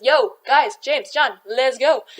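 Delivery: loud, breezy call from the shore; casual call-out. Retold or imitated. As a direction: wave them over, easygoing. Jesus calls James and John ashore.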